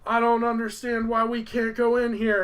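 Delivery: mock protest. Titus protesting at holy ground, mock indignant. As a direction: whine it as a hammed-up objection.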